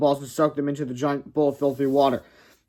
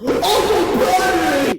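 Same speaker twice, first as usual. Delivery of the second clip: much higher, slow, loud wail; curled up calling his uncle; 7 dB louder, much slower than usual. Acted. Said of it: "he wailed".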